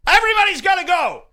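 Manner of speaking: high, loud, fast shout; screaming. Retold or imitated. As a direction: yell to clear out the whole party. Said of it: "So I start screaming".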